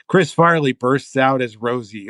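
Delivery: ordinary talk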